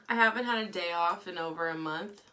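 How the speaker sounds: ordinary talk, nothing marked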